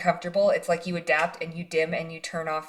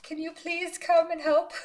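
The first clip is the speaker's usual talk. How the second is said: higher, louder little plea melting into a laugh; mock lonely plea; 10 semitones higher, 5 dB louder than usual. Reader showing the viewer how to ask spirit guides, half-laughing plea. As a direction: play a shy ask for help, then laugh. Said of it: "Just say".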